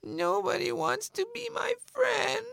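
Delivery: very high, wailing rabbit voice; crying rabbit. Acted. Rabbit alone on a log, crying. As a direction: wail it in a high pitch like a weeping bunny. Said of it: "just started to cry".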